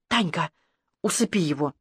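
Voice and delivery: low, hushed instruction; quiet order. A narrator's reading. Ирка tells Танька to put the boy to sleep, quietly.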